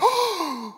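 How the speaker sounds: big audible mock gasp; shocked gasp. Son hears Dipper say stupid, scandalized gasp. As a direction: suck in air in exaggerated shock. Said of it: "audibly gasped. He went".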